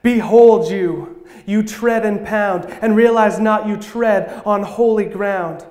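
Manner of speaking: lightly voiced divine command in spoken-word cadence; a hint of thunder, opening strong and easing off; thundering, soothing voice. A narrator's reading. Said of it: "a voice that thundered with a soothing peace".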